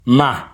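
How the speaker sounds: Ma on a high pitch. Shown as a demonstration. Teacher contrasting tones for learners: Ma high. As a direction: lift Ma up high. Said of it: "Now listen to the contrast".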